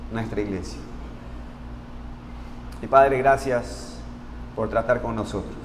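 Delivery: low, soft, reverent praying voice; prayer. Real, not acted. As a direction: lead the congregation in prayer, hushed and measured. Leads a congregational prayer.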